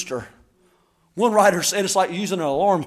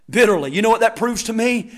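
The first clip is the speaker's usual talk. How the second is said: normal talking voice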